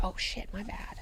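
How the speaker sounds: hushed, embarrassed oops; sheepish whisper. Retold or imitated. Garen whispering sheepishly on learning who Duncan is. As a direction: whisper it like you've been caught out.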